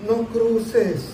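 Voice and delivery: high, soft, sing-song mock plea; mock effeminate tone. Pastor mocking a too-soft warning to a girl crossing, high dainty voice. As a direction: mimic a dainty, over-gentle warning. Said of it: "no tengo que hablar como afeminado".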